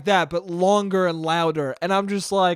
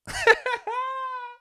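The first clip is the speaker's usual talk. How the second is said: high wheezing laugh. Real, not acted. Laughing at the absurdity.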